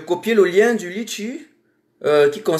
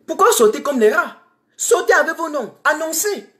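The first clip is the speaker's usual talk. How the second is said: angry challenge; 8 semitones higher than usual. Real, not acted. Activist challenging fleeing officials, angry high demand. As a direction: throw the challenge at them. Daring officials to leave openly.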